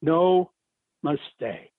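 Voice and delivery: ordinary talk, nothing marked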